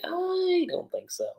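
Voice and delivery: very high, slow, drawn-out reply; retold doubtful answer. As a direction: imitate her, stretching it out up high.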